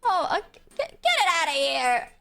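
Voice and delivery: ordinary delivery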